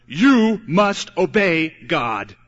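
"you must obey God" word by word, loud and slow; emphatic command. Real, not acted. Preacher summing up what most preachers say, slow emphatic command.

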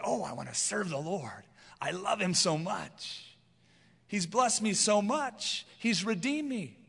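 lightly acted heartfelt devotion, drawn out; motivated believer. Believer motivated out of love, mildly acted grateful fervor. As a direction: gush a little, as a grateful believer. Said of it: "He's motivated".

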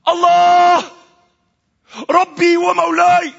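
very high, loud cry to God. Acted. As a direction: wail it out to heaven at full voice. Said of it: "And I cried out".

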